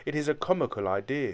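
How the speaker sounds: ordinary delivery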